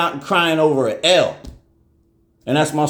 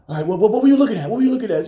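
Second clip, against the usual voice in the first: higher, rushed, frantic questioning; panicked questions; 9 semitones higher, 3 dB louder, much faster than usual. Retold or imitated. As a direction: fire off worried questions fast.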